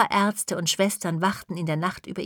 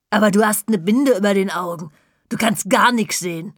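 louder, blunt cleaning lady scoffing; gruff cleaning lady; 5 dB louder than usual. Acted. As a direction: catch him out, blunt and loud. Putzfrau entlarvt die Ausrede. (lauter, trockener Putzfrauen-Einwand)

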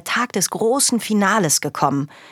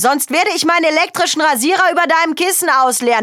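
much higher and louder warning; angry threat; 10 semitones higher, 4 dB louder than usual. Acted. Father threatening Jonathan over the remote, much louder. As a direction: lay down the law.

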